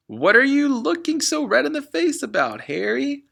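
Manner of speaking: brassy mum calling out; mother asking father; mother's voice. Acted. Mother's voice, shrill question.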